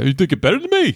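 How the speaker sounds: high-pitched New York tough talk; New York accent. Acted. Saying something about New York to a New Yorker, New York accent. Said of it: "more of a New York accent".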